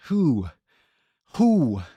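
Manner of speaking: slow, lightly hooted owl voice, mostly his own; owl mode. Pretend. Streamer hoots at chat in a mock owl voice. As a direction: hoot the who like a playful owl. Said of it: "Goes Owl Mode".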